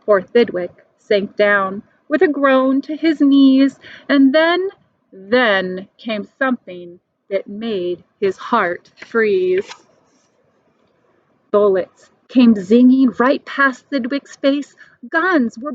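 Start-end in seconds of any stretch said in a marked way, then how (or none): none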